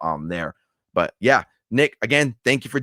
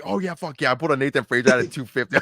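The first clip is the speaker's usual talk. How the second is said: high-pitched retold celebration of a lucky pull; pumped up; 5 semitones higher than usual. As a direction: re-enact an excited outburst, pitched high. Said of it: "I remember being so pumped".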